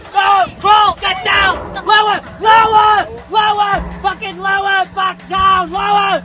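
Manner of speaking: loud barked orders, word after word; drill sergeant shouting. Acted. Drill sergeant bellowing orders at a teammate under the net. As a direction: bellow every command at them.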